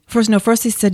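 ordinary talk

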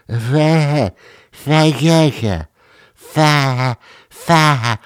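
high, strangled repeated "fa-"; failed pronunciation attempts. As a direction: keep stalling on "fa-" as if unable to finish. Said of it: "I can't say it".

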